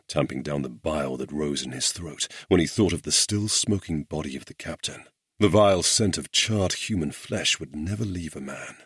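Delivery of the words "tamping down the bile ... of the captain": ordinary delivery, nothing marked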